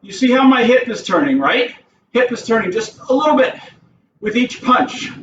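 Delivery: ordinary delivery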